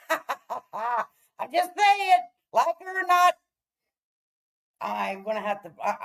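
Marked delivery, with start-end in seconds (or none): 0.1-3.4 laughing. Real, not acted. Laughing at her own bet.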